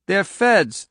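high, urgent nervous warning; anxious waitress voice. A narrator's reading. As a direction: blurt it nervously, high and loud. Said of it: "the waitress said nervously".

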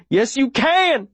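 high-pitched insistent yell; urgent shout. A narrator's reading. Boy to the wounded girl in his arms, shouting. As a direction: shout her out of giving up. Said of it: "I shout".

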